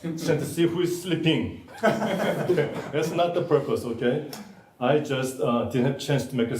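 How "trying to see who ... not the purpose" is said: laughing. Real, not acted. Jokes about photographing sleepers.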